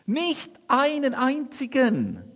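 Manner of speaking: high, loud, slow call over the water; calling across. Acted. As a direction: stretch the shout across a hundred metres. Disciples call across hundred metres. (hoch, laut und langsam gerufen)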